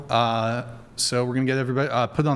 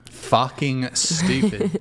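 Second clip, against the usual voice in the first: scornful quote; much slower than usual. Retold or imitated. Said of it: "Enough with the stupid bird".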